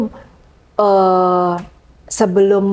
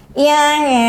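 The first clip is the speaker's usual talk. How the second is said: pitched up high and tinny; shrill voice; 8 semitones higher than usual. Shown as a demonstration. MC coach demoing a bad shrill voice, high and tinny. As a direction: squeak it out thin and piercing. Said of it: "cempreng banget gitu".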